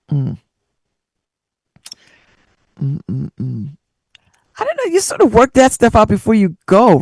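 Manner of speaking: usual speaking voice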